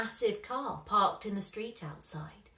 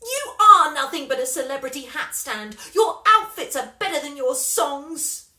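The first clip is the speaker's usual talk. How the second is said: furious shout, much louder; 6 semitones higher, 13 dB louder than usual. A narrator's reading. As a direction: yell it at her to hurt her.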